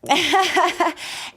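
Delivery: high raspy witchy cackle; witch cackle. Real, not acted. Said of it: "I literally sound like a witch".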